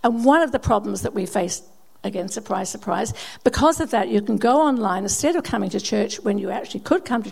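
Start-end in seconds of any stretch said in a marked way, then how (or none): none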